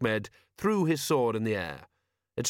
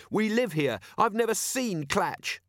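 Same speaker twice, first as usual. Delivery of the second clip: lightly voiced high young boy, protesting; a hint of a stubborn teenage boy; defiant boy; 10 semitones higher than usual. A narrator's reading.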